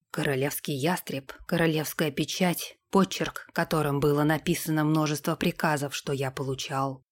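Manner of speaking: low, soft, even recital; unperturbed. A narrator's reading. Sam confirms the order to Derek, unperturbed. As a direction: list the proofs impassively. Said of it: "Невозмутимо ответил Сэм".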